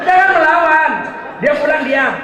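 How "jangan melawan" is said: louder and higher warning; forceful don't-fight-back order; firm command. Real, not acted. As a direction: bark out the warning. Preacher's firm advice.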